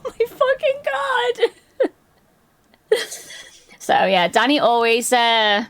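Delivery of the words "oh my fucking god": very high, drawn-out squeal of exasperation; hammed-up exasperated whine; mock despair. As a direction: wail it slowly, way up high. Acting out exasperation at friend's antics.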